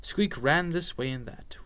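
ordinary delivery

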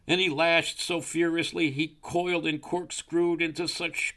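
high, driving narration; excited storytelling. A narrator's reading. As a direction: build the frantic action as a storyteller. Narrates the snake's writhing.

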